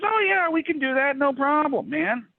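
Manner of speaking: high, breezy mock-casual voice of the engineer; eager yes-man. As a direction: toss it off like nothing's a problem. Engineer eagerly agreeing, voiced in retelling.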